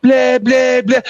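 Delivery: put-on bravado of a challenger; mock their tough talk; mocking taunt. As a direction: mimic their taunt, ending in dismissive blahs.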